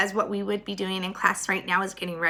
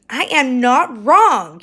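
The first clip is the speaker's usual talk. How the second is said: louder, each word slowed and firm; slow indignant shout; 2 semitones higher, 7 dB louder, slower than usual. Acted. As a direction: insist hard that you are right. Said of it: "Eugenia shouted".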